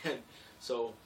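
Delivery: ordinary talk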